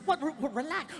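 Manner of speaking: high, stammering mock female voice; flustered girlfriend. Girlfriend trying to calm the yelling man, flustered and high. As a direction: stumble over it in a high pitch.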